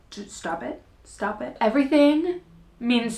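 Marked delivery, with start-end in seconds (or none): 0.1-1.6 much softer self-scolding, under her breath; telling herself to stop crying. Real, not acted. Mom on camera telling herself to stop crying, hushed. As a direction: hush your own tears with the words. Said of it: "I'm literally gonna cry this whole video".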